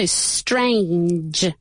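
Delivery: "strange" slowly, ending in a voiced ch, a j sound. Shown as a demonstration. Teacher summing up for learners, slow "strange" with a buzzed ending. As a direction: stretch "strange" into its final j. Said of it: "pero vibrada".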